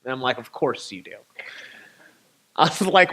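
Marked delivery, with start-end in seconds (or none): 0.4-1.2 deadpan resignation. Retold or imitated. To the mechanic promising bad news, soft deadpan resignation. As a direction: lower and much softer, a weary sigh of a line.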